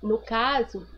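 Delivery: ordinary delivery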